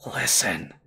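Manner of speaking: throaty urgent whisper; hissed whisper. Acted. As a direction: hiss it from the throat. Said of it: "Frankie hissed".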